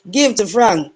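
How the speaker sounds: ordinary talk, nothing marked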